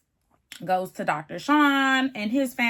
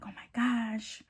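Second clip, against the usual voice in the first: slow, soft whisper; whispered aside; 9 dB quieter, slower than usual. Retold or imitated. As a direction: imitate a bride whispering slowly to her mom. Retold aside to her mom.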